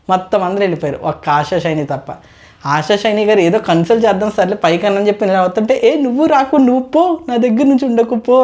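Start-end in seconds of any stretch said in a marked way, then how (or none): 5.8-8.4 higher, sharp mock shout of go away; angry dismissal. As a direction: mimic her shooing someone off, raised. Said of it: "అని చెప్పాను అంది".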